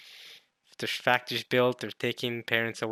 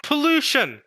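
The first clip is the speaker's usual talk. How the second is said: high, loud burst; sudden exclamation; 8 dB louder than usual. Real, not acted. Finally finding the lost word, excited cry. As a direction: blurt it out loudly, high and falling.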